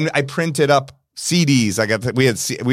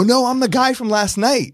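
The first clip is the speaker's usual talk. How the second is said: pleading self; 11 semitones higher than usual. Retold or imitated. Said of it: "And I go".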